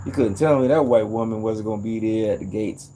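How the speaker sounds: ordinary talk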